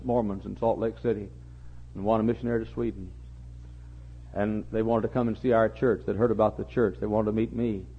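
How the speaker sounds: ordinary talk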